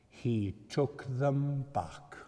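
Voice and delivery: slow, gentle statement; slow and measured. Acted. Repeated line, quiet then loud.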